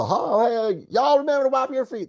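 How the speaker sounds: high-pitched, quick holler. Shown as a demonstration. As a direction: demonstrate a mother's high holler. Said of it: "That's what hollering is".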